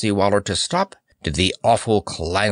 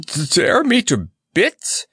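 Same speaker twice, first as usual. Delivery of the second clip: high, stammered alarm; gulping fright; 10 semitones higher, 3 dB louder than usual. A narrator's reading. Clown threatened with the lions, gulping in fright. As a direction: stumble on the first sound, aghast. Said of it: "gulped the clown".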